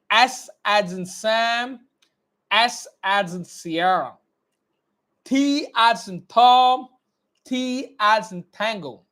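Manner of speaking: slow, clearly mouthed letter drill; teacher drilling phonetic alphabet; slow dictation. Shown as a demonstration. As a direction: model every sound slowly. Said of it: "Always focus on my lip movement".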